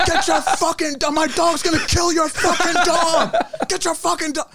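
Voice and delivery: high screaming yell at the neighbors; retold scream. Screaming at the neighbors to grab their dog, shrill yell. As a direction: scream it like you've lost it. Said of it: "I just started fucking screaming".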